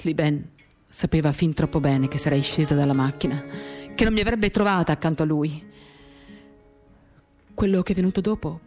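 ordinary talk

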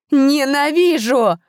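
high, loud, slow scream of hatred. A narrator's reading. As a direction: scream it into the void. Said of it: "продолжала кричать императрица".

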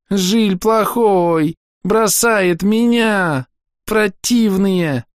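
put-on high, slow howling little girl complaint; sad wailing child. A narrator's reading. Girl wails into the priest's jacket, high and drawn out. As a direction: wail it all out self-pityingly. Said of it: "грустный вой".

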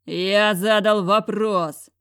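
slow, loud bellowed demand; roar. A narrator's reading. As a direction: roar the question at her. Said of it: "взревел зверь". (медленный рёв)

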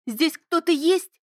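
high, slow, scared call into the room; frightened cry. Acted. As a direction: call out afraid, rising at the end. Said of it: "выкрикнула".

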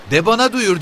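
very high, loud, challenging woman; defiant retort. Acted. The mother defies the officer, high loud challenge. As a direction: snap back at him defiantly. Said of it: "diye diklendi kadın".

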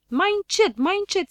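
very high, loud pained cry. A narrator's reading. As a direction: yelp at him to be gentle. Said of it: "strigă Ochi Albaștri". (strigăt ascuțit de durere)

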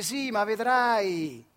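very slow, drawn-out mock reassurance; imitating a soothing boss. Boss stalling the worried worker, slow mock-soothing voice. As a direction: stretch it out like empty comfort. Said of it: "Ti dice".

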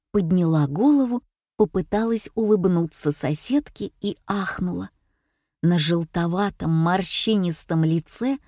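ordinary delivery

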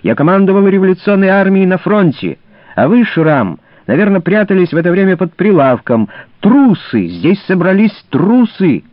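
high, loud furious yelling. A narrator's reading. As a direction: scream the insults at him. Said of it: "завопил тощий Виллих".

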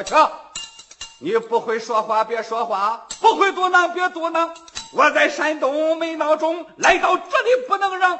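put-on loud, scolding Wu Song cutting the old man off; furious shouted rebuke; angry shout. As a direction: bark him down, hero losing his temper.

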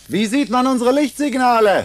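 loud, high call over a distance; girl calling to a friend outside, loud and high. Acted. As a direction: shout the question across.

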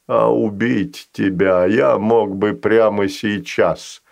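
a patient creature striking a deal. Acted. Fazanol voice.

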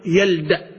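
يلدأ with the dal carrying a fatha. Shown as a demonstration. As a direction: end يلدأ on an open a. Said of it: "أي بالفتح". (يلدأ بفتح الدال)

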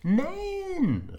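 put-on sharp, high child's cry of no; a boy's high protest; Willi calling out. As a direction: cry out your denial. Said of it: "rief Willi".

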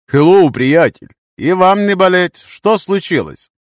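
loud, high hail to strangers; calling out. Acted. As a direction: call across the road, stretching the words. Hero hails stranded strangers.

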